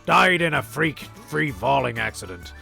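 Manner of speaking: ordinary talk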